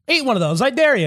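high, rising taunt; daring customer. Retold or imitated. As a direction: dare him to eat it. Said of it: "I had a table one time that was like".

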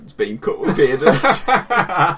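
laughing through the words; cracking up while speaking. Real, not acted.

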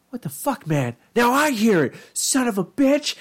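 high, hammed-up cartoon rage; mock angry yell. Retelling a Flash cartoon, mock furious high yell. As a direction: yell it like an enraged cartoon guy. Acted cartoon character yelling.

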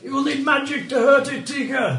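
urgent warning. Acted. GM warns character loudly.

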